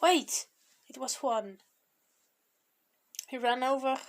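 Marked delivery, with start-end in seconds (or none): 0.0-0.5 higher, louder sudden call. A narrator's reading. Juan calls out to the departing pair, calling out. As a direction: call out to stop them. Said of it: "a voice called out to them".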